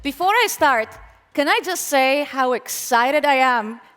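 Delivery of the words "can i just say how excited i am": ordinary talk, nothing marked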